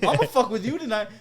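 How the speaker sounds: high, loud playful vow; mock gleeful threat. Retold vow to grandma.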